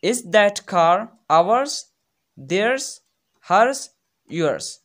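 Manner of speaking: very slow, each word set apart; teacher listing options; slow listing for class. Shown as a demonstration. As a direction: list each word slowly. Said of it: "दूसरा को देखो".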